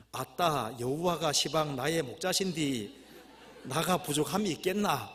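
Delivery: slow, emphatic Jeolla reading; Jeolla dialect. Acted. Preacher reading the Jeolla version of Psalm 23, Jeolla dialect. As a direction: put on a Jeolla accent, stretching the endings. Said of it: "전라도 버전".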